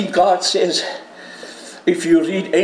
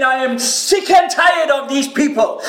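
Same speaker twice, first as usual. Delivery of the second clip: exasperated God; 8 semitones higher than usual. Acted. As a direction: throw up your hands in disgust. Voicing God fed up.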